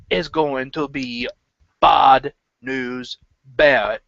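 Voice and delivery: slow, drawn-out mock announcement; Bad News Barrett. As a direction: imitate a wrestler's theatrical delivery of bad news, pausing for effect. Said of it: "I think I'm just gonna say this".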